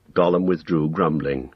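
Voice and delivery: ordinary delivery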